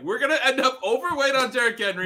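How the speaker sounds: rising, animated prediction; excited. Real, not acted. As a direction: get fired up about it. Hyping Derrick Henry prediction.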